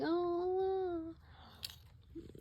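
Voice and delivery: high amazed gasp of wonder; surprised wow. Pretend. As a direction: sound amazed, pitch jumping up. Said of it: "and you're like".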